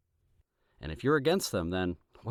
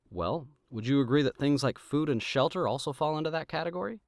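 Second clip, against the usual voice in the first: ordinary talk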